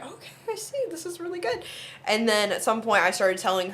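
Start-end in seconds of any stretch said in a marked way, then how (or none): 0.0-1.6 impressed grandma. Retold or imitated. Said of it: "she was like".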